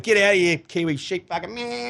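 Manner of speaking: imitating a sledging Aussie, then a sheep bleat; lightly hammed-up on-field abuse; mock sledging. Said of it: "mouthing off".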